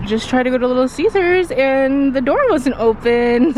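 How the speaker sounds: too loud. Real, not acted. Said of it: "I'm so loud".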